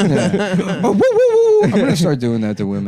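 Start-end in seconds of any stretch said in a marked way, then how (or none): 0.8-1.6 very high cartoon hoots; mock catcall whooping. Hooting as a joke about doing that to women, mock whooping. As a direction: whoop it up high in quick bursts.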